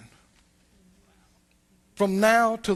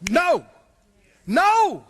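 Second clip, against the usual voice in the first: firm refusal; 8 semitones higher, 5 dB louder than usual. Acted. Acted rebuke of tempting thought.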